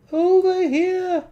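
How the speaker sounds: high squeaky voice. Pretend. Toy figure calling to the builder in a squeaky voice.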